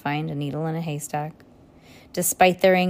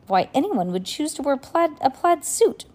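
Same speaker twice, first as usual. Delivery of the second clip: baffled; 8 semitones higher than usual. A narrator's reading. Children puzzled by stranger's plaid suit, high bafflement. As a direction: voice climbing high in mild disbelief.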